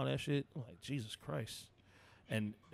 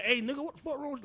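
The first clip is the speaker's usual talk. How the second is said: high loud confrontational yell; mock aggressive call-out; 12 semitones higher, 6 dB louder, faster than usual. Showing the wrong way to confront the guy. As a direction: bark it at the stranger. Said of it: "you don't wanna be like".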